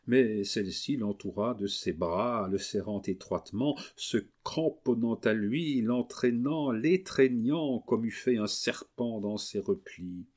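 plain reading voice

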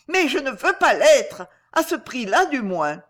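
high, loud refusal; emphatic protest. A narrator's reading. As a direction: burst out refusing, then ease off slightly. Daughter protests against becoming princess. (protestation vive, voix haute)

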